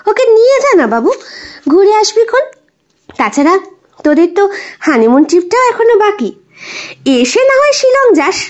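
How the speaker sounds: put-on an old grandmother urging her grandson gently on; grandmother's voice.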